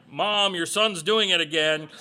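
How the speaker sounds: loud, whiny tattle to mom; tattling sibling. Retold or imitated. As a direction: play the jealous big brother telling on his sibling.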